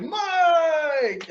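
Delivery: high mock cheer of his name; calling out. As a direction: call his name like the locker room. Everyone backstage calling his name.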